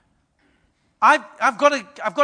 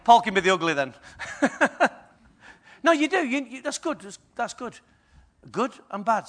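ordinary talk, nothing marked

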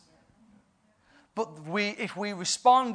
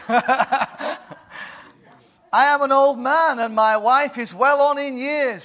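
put-on slow, higher doubting old priest; lightly voiced, puzzled old man asking; doubting Zechariah; 7 semitones higher, 7 dB louder, slower than usual. Zechariah questions the angel, lightly voiced and doubtful.

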